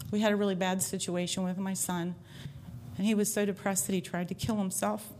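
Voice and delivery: usual speaking voice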